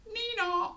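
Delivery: high two-note nee-naw; imitated police siren; siren noise. A narrator's reading. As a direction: wail like a police car. Police siren as cops come.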